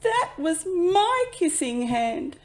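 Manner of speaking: put-on high, slow, loud heartbroken child; saddest gasp. A narrator's reading. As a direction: gasp it out as a hurt little boy. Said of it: "Chester gasped in the saddest voice".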